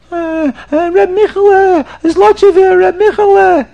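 high, loud hammed-up wail; crying like a baby. Sinner confesses to the Baal Shem Tov, mock bawling. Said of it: "crying and screaming in the shul like a baby".

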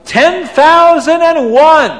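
high, ringing slow call; loud, high-pitched proud bid. Acted. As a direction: call out the bid loudly, stretching each word. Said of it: "very loud".

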